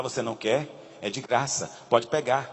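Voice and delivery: low, soft coaxing devil; tempting devil. Acted. The devil offering free pizza, low soft coaxing. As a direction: tempt them quietly, low and sly. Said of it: "Ele fala".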